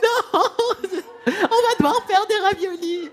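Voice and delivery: high, loud drawn-out wail; hammed-up tearful collapse; mock despair. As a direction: howl over a ruined holiday meal. Mother despairs when time runs out.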